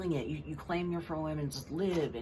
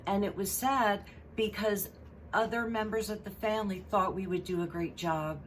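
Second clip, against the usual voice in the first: usual speaking voice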